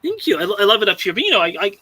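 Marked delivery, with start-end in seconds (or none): none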